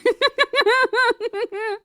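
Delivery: high, drawn-out laughing fit; helpless laughter. Real, not acted. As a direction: crack up in a long high cackle. Cracking up at the screaming image.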